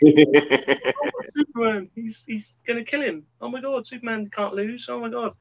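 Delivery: high mock-tearful wail; father imitating his daughter crying over Superman. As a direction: play the upset kid, voice up high.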